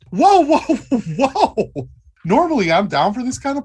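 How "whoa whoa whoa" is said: high, loud startled whoa; alarmed whoa. Acted. Player reacting to a gun drawn, loud startled alarm. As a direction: jump in alarm.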